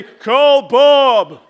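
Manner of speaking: mock-grand voice from the heavens; booming movie voice of God. Pastor mocking a Hollywood voice of God, booming. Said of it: "Morgan Freeman to come to you and just say".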